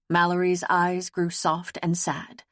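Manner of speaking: usual speaking voice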